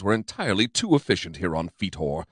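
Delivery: usual speaking voice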